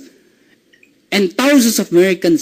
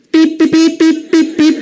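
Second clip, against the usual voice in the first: high, even car-horn beeps; playful high-pitched tooting; imitated car horn; 6 semitones higher than usual. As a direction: honk with your voice in short beeps.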